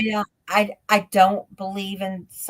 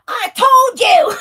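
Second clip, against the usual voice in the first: high, loud, drawn-out I-told-you shout through laughter; wife's triumphant yell; 10 dB louder, much slower than usual. Retold or imitated. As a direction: crow it loudly, imitating a vindicated wife. Wife's gloating retort acted out.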